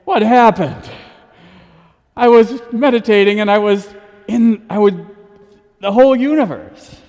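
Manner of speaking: bewildered soul coming back from the universe; astonished cry of someone just back from meditation; amazed returning soul. Pretend. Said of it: "it's like".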